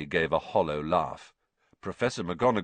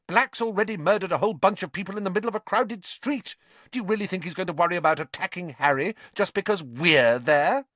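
quick, high-pitched tirade; heated, rapid scolding; snapping; 11 semitones higher, 3 dB louder, faster than usual. A narrator's reading. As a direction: argue fast in exasperation. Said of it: "snapped Hermione".

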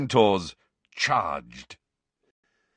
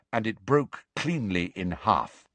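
ordinary delivery

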